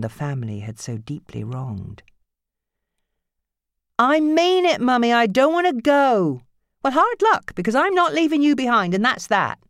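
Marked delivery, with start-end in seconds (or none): 4.0-6.4 high, loud protest; teen shouting at her mother; defiant teen girl. Acted. Sammy voiced. 6.8-9.6 high, loud, quick; mother overruling her daughter; firm angry mother. Acted. Nancy voiced.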